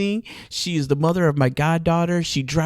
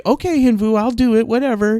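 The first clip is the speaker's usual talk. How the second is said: high, loud, mock-resigned agreement; resigned giving in; 7 semitones higher, 6 dB louder than usual. Caving to bossy friend Hin Vu, mock-resigned. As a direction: give in like a pushover. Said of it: "normally I'm like".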